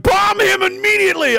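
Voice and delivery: high-pitched, hammed-up shout; mock outraged cry. Imitating officials reacting to the vial, mock panicked shout. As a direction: demand it like a panicked warmonger. Acted panicked crowd demanding bombing.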